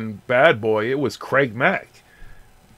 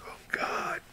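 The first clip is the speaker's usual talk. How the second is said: breathy whispered dismay; a whispered inner sigh; whispered groan; 15 dB quieter than usual. Retold or imitated. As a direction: whisper it like you can't believe it. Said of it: "And I'm just like".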